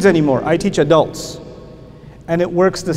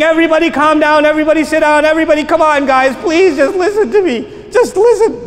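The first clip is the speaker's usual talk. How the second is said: raised pleading call to a rowdy class; pleading over chaos; 9 semitones higher, 3 dB louder than usual. Retold or imitated. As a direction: beg the class to calm down, loud. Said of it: "When I was saying".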